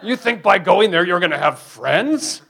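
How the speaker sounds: loud, mocking demon with a laugh; voicing the demon. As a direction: sneer it out, laughing at him. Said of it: "And then the demon says".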